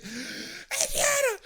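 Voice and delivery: very high, drawn-out mock scream; imitated scream. Man imitating his girlfriend calling the kid, screaming. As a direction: scream for a child, mimicking her. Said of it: "she's screaming at the kid".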